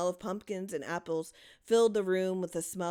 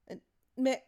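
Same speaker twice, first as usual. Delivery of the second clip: higher, stammering call; worried call; 7 semitones higher, 4 dB louder than usual. A narrator's reading. Louise calling her daughter across the dark cellar, worried. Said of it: "called Louise".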